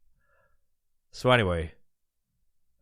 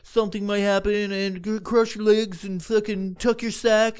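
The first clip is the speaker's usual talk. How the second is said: higher, sing-song rule recital; mocking the lifeguard; 8 semitones higher than usual. Mocking the teen lifeguard reciting slide rules, higher and sing-song. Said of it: "he's like".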